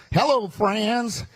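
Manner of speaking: put-on slow, drawn-out Southern Illinois accent; lightly imitating her running mate's Southern Illinois accent. Teasing the crowd about her partner's way of talking, mock Southern Illinois accent. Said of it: "He's got that accent".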